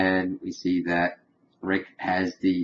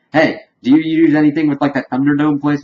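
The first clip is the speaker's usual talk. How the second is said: raised, louder acted call; 6 semitones higher, 6 dB louder than usual. Rick creating a distraction, calling out. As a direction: grab their attention with a loud hey.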